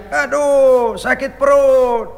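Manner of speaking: a hammed-up belly groan; mock groan. Overfed man complains to Umar, mock groaning.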